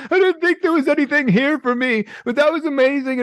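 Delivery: high, breaking voice of a grateful guy; high, emotional imitation of the boyfriend; moved boyfriend. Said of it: "He's like".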